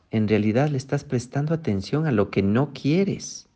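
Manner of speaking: ordinary talk, nothing marked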